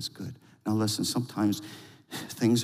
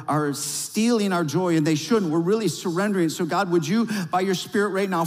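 higher, louder pleading prayer; fervent prayer; 7 semitones higher, 4 dB louder than usual. Acted. Pastor interceding for the church in fervent, raised prayer. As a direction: press in hard, pleading for the crowd.

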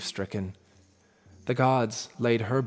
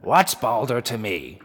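put-on scornful old giantess; 6 dB louder than usual. A narrator's reading. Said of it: "she replied".

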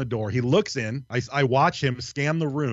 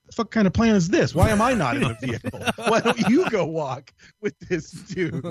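incredulous outburst; 8 semitones higher than usual. Retold or imitated. Narrator retelling his protest to friends, incredulous high yell. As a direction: fire back at a ridiculous plan, laughing at the end. Outraged at being sent to walk.

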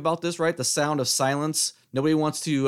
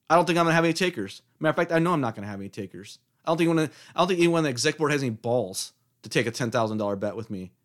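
ordinary talk, nothing marked

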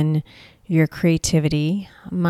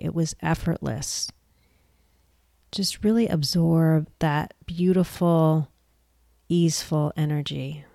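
softer, unhurried meditation voice with long pauses; guided meditation; 5 dB quieter than usual. Acted. Mentor leading listeners into a creativity meditation, guided meditation voice. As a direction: guide them gently through breath and stillness, leaving space between phrases.

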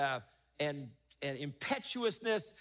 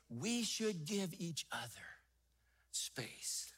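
softer, dropping to a whisper on space; hushed; 2 semitones lower, 10 dB quieter, slower than usual. Real, not acted. Preacher urging the congregation to give space, whispered. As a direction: lean in and whisper the point. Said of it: "dropping to a hush".

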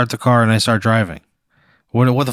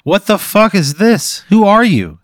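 higher-pitched, drawn-out shocked demand; alarmed demand; 9 semitones higher, 4 dB louder than usual. Acted. Patient confronting the face on his head, alarmed. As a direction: blurt it out high in alarm. Discovers a face sewn on him.

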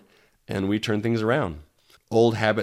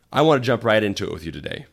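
ordinary talk

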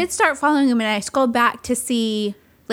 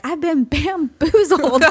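slow, drawn-out "bamboozled" in a hint of a Southern old man; Leslie Jordan impression; 4 semitones higher, slower than usual. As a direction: savor "bamboozled" like a sly old Southern gentleman. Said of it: "where he's like".

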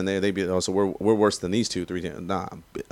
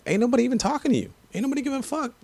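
much higher, fast, worked-up rant; heated rant; much faster than usual. Real, not acted. Raider fan ranting at Niners fans, heated and high. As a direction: fire it back at the rival fans, fast and high.

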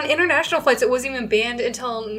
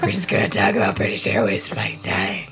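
much lower, rough demon-like growl; smoker voice. Retold or imitated. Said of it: "my smoker voice".

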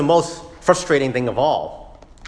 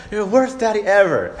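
put-on higher childish whine; sulky child; 8 semitones higher than usual. Son accusing his father, childish whine. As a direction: play the pouting little boy. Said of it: "He says stuff like".

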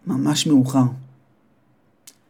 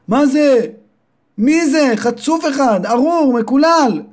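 screaming mother; 11 semitones higher, slower than usual. Acted. Mother screams about the stolen money, high and outraged. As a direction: shriek it like a furious mother. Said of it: "שמע פתאום את אמא שלו צועקת".

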